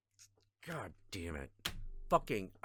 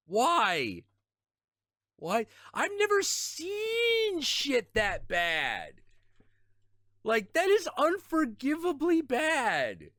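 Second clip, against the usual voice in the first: much higher, louder, slow outraged rant; furious disbelief; 12 semitones higher, 4 dB louder, slower than usual. Real, not acted. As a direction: let the outrage boil over. Fuming at teammate's play.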